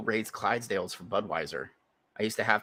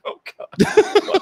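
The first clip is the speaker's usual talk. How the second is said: high, loud comic wail; hammed-up agonized groan; mock dismay; 10 dB louder than usual. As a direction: cry out in exaggerated dread. Retold dismay at practising injured.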